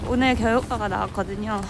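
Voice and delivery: normal talking voice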